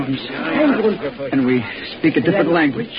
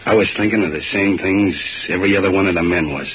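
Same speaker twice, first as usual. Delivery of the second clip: low, measured retelling of the war; story narration; 12 semitones lower than usual. Acted. Soldier recalling the gravel pit, grave narration. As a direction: narrate it as a grim memory.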